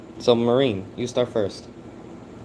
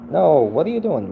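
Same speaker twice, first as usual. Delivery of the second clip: higher and louder, alarmed; alarmed protest; 7 semitones higher, 5 dB louder than usual. Real, not acted. Reacting to someone's actions.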